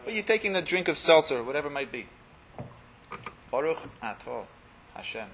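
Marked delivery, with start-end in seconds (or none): 3.5-5.3 Baruch Atah Hashem said softly, dropping almost to a murmur on Hashem; hushed, intimate bracha with Hashem barely voiced; lower voice. Shown as a demonstration. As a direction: say the blessing quietly, as if God is right beside you. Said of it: "we would say "Atah" in the lower voice".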